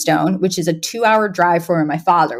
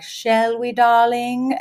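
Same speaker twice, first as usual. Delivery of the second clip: higher, restrained mock-English murmur; understated upper-class English, pitched up; reserved British; 4 semitones higher, much slower than usual. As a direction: imitate a very reserved posh British couple. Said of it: "like, reserved way".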